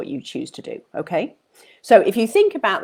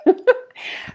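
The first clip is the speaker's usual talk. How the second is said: giggle; 13 semitones higher than usual. Real, not acted. Laugh after sharing secret.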